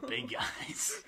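high breathy whispered joke; mock whisper. Joking about the big guys on the podcast, whispered.

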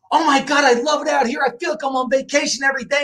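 high, excited imitation of mom; excited gushing. Elderly mom telling her son about retirement living, gushing.

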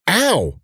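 sharp high yelp of pain. Acted. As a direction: yelp as if stung. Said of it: "Amana yelped".

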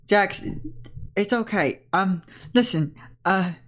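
falter, then address the team; earnest young Riley. Acted. Riley consoles Jax, addresses team.

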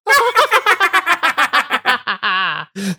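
high, loud witchy cackle; evil cackle. Acted. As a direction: cackle like a horror host. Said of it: "Mother Larissa's sweet, sweet intro".

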